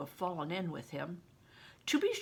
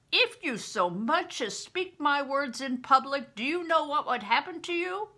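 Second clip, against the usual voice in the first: louder, higher, with a hard angry edge; hard edge of anger; 7 semitones higher, 6 dB louder than usual. Acted. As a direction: warn him with cold anger. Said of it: "he added with a hard edge of anger".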